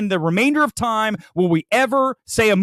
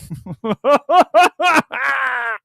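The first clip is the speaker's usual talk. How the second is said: real laugh after the mock swoon; loud laugh; 6 semitones higher, 3 dB louder than usual. Real, not acted. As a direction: burst of high cackling laughter.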